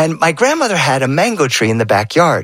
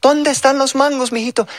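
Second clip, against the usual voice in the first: higher, gentle abuela voice; grandmother's voice; 12 semitones higher than usual. Acted. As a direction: lightly voice a sweet old grandma.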